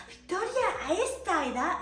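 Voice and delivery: high scolding godmother voice; tut-tut at her age. Retold or imitated. Godmother scolding Victoria for snacking, high scolding voice. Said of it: "en francés".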